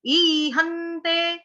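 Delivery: ईहन्ते slowly with हन्ते doubled, two half न; double n. Shown as a demonstration. Teacher showing the doubled न in ईहन्ते. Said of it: "आधे आधे दो न आपके भी आने चाहिए".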